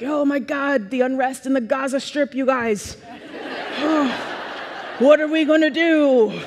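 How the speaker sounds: high, hammed-up despair; mock anguish. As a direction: lament world news like an overwrought child. Child frets over world news.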